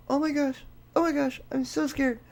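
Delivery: a hammed-up little frightened whimper; mock fright. Host imitating the filmed people's panic, mock fear. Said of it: "these people, their reaction".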